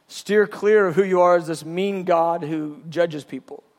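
ordinary delivery

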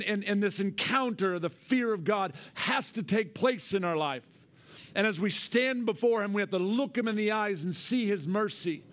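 loud, high fiery preaching. Acted. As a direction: preach with full force. Preaching at full force.

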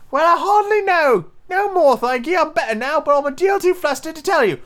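very high-pitched squeaky creature; flustered little creature squealing up high; little squeaking voice. A narrator's reading. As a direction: squeak it out like a small, flustered animal. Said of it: "a little feeble squeaking voice".

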